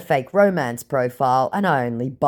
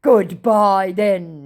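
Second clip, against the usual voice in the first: huffy goodbye; 4 semitones higher, 5 dB louder, much slower than usual. Retold or imitated. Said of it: "he said".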